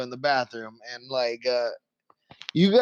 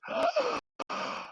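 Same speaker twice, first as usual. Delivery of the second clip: high, sharp gasp of revival; sudden gasp; 7 dB quieter than usual. Acted. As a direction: gasp back to life. Healed man comes back to life.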